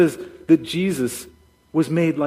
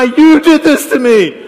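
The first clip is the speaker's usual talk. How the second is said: high, drawn-out shout of blame; mock yelled accusation; 10 semitones higher, 13 dB louder, slower than usual. [name] pointing at the sky to blame God, mock yelling. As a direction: hurl it loudly upward, hammed-up. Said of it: "pointing her finger at the sky".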